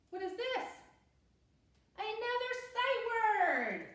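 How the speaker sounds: high, sing-out teacher delight, drawn out at the end; playful excitement. Shown as a demonstration. Teacher finds another sight word for pupils, excited. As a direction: exclaim it high, stretching the last words slowly.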